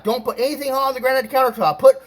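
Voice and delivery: high, fussy imitation of a landlady; stressing landlady. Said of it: "she was stressing about".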